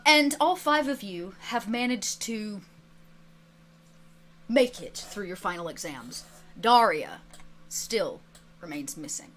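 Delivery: low, soft, suspenseful narration with pauses; quiet, weighty cliffhanger ending; game master sign-off. Acted. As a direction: close the episode in a hushed storyteller's voice. Game master's solemn episode ending.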